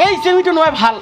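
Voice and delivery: loud, high-pitched indignant outburst; indignant scolding. Acted. Acted scolding in a quarrel.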